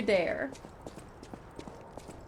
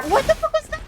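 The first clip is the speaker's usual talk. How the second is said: sudden much higher pitched cry; shocked squeal; 3 dB louder, faster than usual. Real, not acted. Player shocked by a creature, high squeal.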